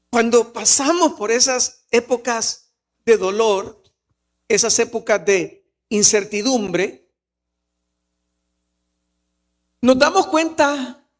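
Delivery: slow and loud, building; impassioned preaching. Acted. Pastor presses the congregation about seasons of pain, slow and loud. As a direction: lean into each phrase from the pulpit.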